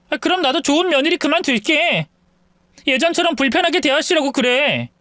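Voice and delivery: wife telling her husband she is done being the good daughter-in-law; fed-up wife. Acted. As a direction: throw the threat back at him.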